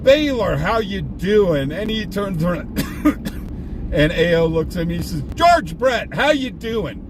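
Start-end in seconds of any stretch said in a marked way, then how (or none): 0.0-1.7 big, loud sing-out of the name, higher; acted-out exuberant hello; hearty greeting. Hailing a star, showing off. 5.3-7.0 acted-out exuberant greeting, pitched well up; hearty greeting. Star hails him back, playing along.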